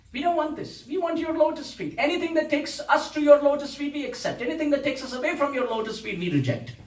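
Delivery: fervent plea. Retold or imitated. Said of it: "They spat at that position".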